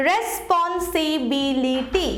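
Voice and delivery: said slowly; slow model word. Shown as a demonstration. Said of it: "দেখো কি হলো".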